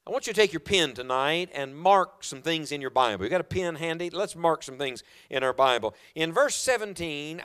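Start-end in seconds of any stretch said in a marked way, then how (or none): none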